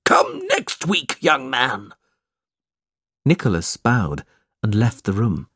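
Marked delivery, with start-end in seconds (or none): 0.0-1.9 put-on stern old man. Bray dismissing Nicholas.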